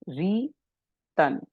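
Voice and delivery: रीतन drawn out slowly, a long री, then तन set apart; pronunciation demo. Shown as a demonstration. Teacher showing students how the symbol is pronounced, रीतन slow and split. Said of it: "इसको कैसे pronounce करेंगे".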